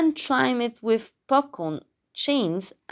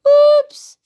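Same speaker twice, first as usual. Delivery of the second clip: very high, loud little yelp; croaked oops; 12 dB louder than usual. A narrator's reading. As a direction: squeak out an embarrassed frog's oops. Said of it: "croaked Froggy".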